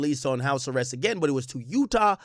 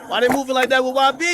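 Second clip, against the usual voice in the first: high, fast disbelief; outraged; 11 semitones higher, 8 dB louder, faster than usual. Real, not acted. Reacting to video.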